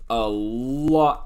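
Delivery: "a lot" stretched out very slowly; stress on LOT. Real, not acted. Reviewer noting how much the film packs in, stressing "lot". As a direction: draw out "a lot" for emphasis. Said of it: "I'm gonna emphasize that".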